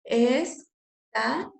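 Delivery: "Esta" split into two syllables, each lifted at the end. Shown as a demonstration. Teacher dividing the verse for students, syllable by syllable. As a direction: break "Esta" apart with a clear gap. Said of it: "Vamos a dividir esta".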